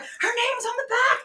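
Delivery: high, near-shouting excitement; excited outburst. Retold or imitated. Fan telling strangers she knows the singer, excited. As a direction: burst out thrilled.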